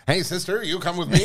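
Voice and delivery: lightly voiced old-time tough guy; a hint of a forties wise guy; old movie greaser. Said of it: "a little bit of that".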